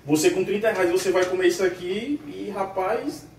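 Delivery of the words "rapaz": ordinary delivery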